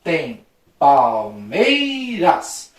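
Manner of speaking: very slow, each syllable drawn out; slow syllable-splitting demonstration. Shown as a demonstration. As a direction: chant out every syllable separately for a class. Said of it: "vamos separar as sílabas normal".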